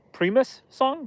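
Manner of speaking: high, surprised question. Real, not acted. Streamer surprised by a chat guess, asking in high surprise.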